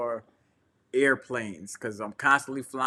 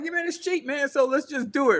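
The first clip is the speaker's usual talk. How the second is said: excited; 10 semitones higher than usual. Retold or imitated. Retold eager self, pitch jumps.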